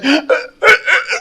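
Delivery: jadeo agudo y entrecortado imitado; trabado del susto. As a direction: imita a alguien que se queda trabado. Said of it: "se trabó todo el pobre". (sonido ahogado de sorpresa, actuado)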